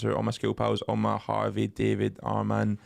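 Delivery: ordinary talk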